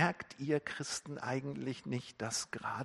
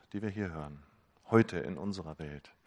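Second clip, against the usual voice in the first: ordinary talk, nothing marked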